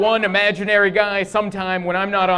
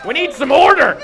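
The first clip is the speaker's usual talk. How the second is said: louder, higher, barked demand for order; mock shout; 8 semitones higher, 8 dB louder than usual. Comedian voicing his inner Republican, mock stern shout. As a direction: bark it like a stern old conservative.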